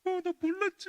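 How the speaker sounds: high, loud mock sobbing; crying child. Little girl crying to her mother, high mock sob. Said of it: "이러면서 울어".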